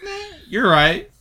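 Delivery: squeaky high meh, you're right; mock high concession. Mock concession to teased pick.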